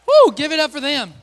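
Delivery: rally the room to applaud; loud, high crowd-hyping call. Real, not acted. Calling applause for contestants.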